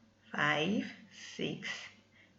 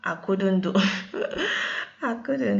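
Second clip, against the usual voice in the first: tired laugh breaking through, higher; laughing at herself; 3 semitones higher, 5 dB louder than usual. Real, not acted. Vlogger admitting to viewers she gave up, laughing. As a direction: giggle through the words. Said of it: "I was exhausted".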